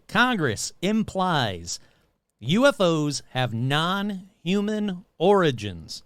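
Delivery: slow, deliberate headline read; emphatic repeat. Real, not acted. Host repeats the UFO news for listeners, slow and emphatic. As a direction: spell it out slowly, weighting every word. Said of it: "I'm gonna repeat that real quick".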